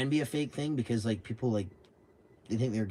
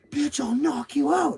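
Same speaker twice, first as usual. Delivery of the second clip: high, slow, drawn-out mock threat; puffed-up threat; 14 semitones higher, 4 dB louder, much slower than usual. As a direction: imitate a man squaring up, stretching each word. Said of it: "He gets all up like this".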